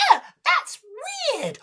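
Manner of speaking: very high, squeaky reaction; tiny high creature voice; little crab. Acted. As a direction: squeak it out, put off.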